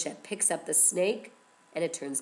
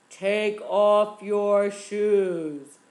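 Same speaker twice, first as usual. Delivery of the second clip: powerful voice; 8 dB louder, much slower than usual. A narrator's reading. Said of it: "it sounded like a powerful voice".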